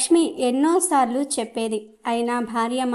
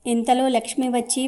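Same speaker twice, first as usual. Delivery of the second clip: usual speaking voice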